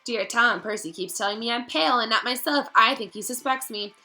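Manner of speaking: high, loud and rushed panic; a frightened girl losing her grip; desperate diary pleas building to a cry of Tom; frantic Ginny. Acted.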